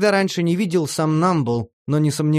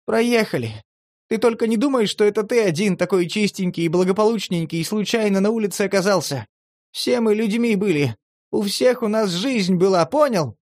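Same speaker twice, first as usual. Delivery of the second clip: much higher, bitter, emphatic; bitter lecture; 7 semitones higher than usual. A narrator's reading. Vena lectures Demid bitterly, much higher. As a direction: remind him they were all people once.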